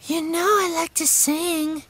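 put-on very high, tiny child voice; high-pitched small kid; little sister's voice. A narrator's reading. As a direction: squeak it like a little girl.

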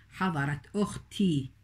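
حضرت said with its final t, run on into أختي; connected speech. Shown as a demonstration. Teacher showing the t of حضرت in running speech. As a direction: sound the t of حضرت clearly before أختي. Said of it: "لاحظي التاء تلفظ وهي في درج الكلام".

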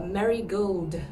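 marigold as the flower. Shown as a demonstration. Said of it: "sounding almost like the flower".

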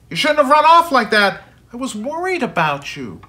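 worried shout. Acted. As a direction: scold then let the worry show. Father finds runaway son.

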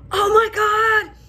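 high, loud, slow startled cry; shocked gasp. Retold or imitated. Realising the cup is on her roof, high loud shock. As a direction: burst out in sudden alarm. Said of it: "And I went".

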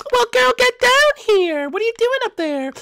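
loud, high scolding call; high-pitched, forceful summons. Acted. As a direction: holler at a friend to get down. Calling Daisy down from hiding.

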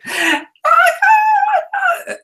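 long, very high gasp of delight; excited gasp. Retold or imitated. Wife hearing it's Cumberbatch, a huge thrilled gasp. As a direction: gasp like she just heard amazing news. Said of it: "She's like".

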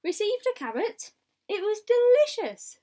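light up at the carrot; delighted. A narrator's reading. Wombat delighted with reward.

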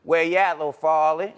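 relaxed New Orleans "where you at"; New Orleans greeting, loose and easy; New Orleans accent. Shown as a demonstration. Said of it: "This is how you would say".

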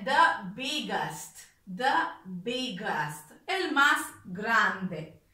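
"the biggest" drawn out very slowly, clearly articulated; slow right down on "the biggest" for learners; slow model pronunciation. Shown as a demonstration. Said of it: "model pronunciation slowed". ("the biggest" muy lento, para que repitan)

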